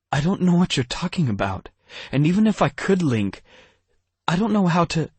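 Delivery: put-on flustered girl voice climbing into alarm; panicked. Hope panicking, much higher and louder.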